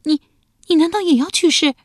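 high, stammering, frightened cry; frightened question. Acted. Wife fearing her husband means to die, high frightened stammer. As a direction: gasp it out in fear. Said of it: "瞪着一双惊恐的眼睛问道".

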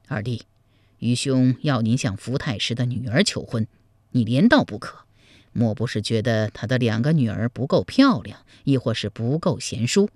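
low-pitched, gentle questioning; probing adviser Zhao Pu. Acted. Zhao Pu asking his younger sworn brother why he refuses, low and patient.

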